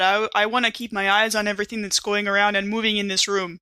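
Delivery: ordinary talk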